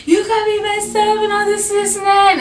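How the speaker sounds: very high, loud retold yell; re-enacted high-pitched yelling; yelling out the car. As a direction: holler it high like an upset outburst. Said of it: "yelling out the car".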